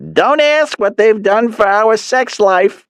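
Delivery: high, slow mother's voice; Mom voice. A narrator's reading. Mother urging her son on the phone, high and slow.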